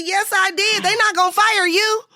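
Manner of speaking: high-pitched, reenacted arguing; defiant comeback. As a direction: argue back without backing down. Retold defiant argument on set.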